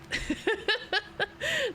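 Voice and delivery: very high falling giggle. Real, not acted. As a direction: laugh it off. Laughs about starving.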